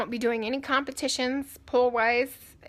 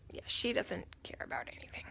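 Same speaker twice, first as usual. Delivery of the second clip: whisper; 3 semitones lower, 11 dB quieter than usual. Real, not acted. Aside about the cat.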